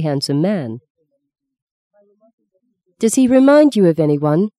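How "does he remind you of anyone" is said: idle question. A narrator's reading. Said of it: "Meggie asked idly".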